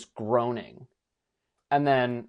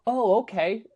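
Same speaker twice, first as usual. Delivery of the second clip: puzzled surprise; 10 semitones higher, 4 dB louder, slower than usual. Retold or imitated. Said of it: "And you're like".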